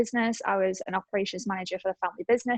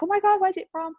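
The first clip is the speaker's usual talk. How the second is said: much higher, eager gushing question; excited stranger; 10 semitones higher, 4 dB louder than usual. Retold or imitated. Woman on the beach admiring the bike, excited and high. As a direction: gush like a thrilled stranger spotting the bike.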